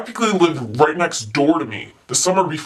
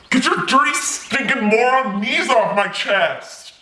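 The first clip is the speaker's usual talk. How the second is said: raised, drawn-out yell from under his weight; angry struggling demand; 4 semitones higher than usual. Acted. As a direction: demand release, insulting him. Pinned boy demands release.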